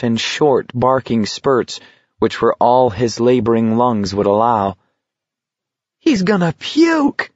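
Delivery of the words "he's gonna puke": very high, gleeful squeal; ecstatic cry. A narrator's reading. Someone in the crowd cries out in an ecstasy of delight. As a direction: shriek it out with delight. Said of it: "cried in an ecstasy of delight".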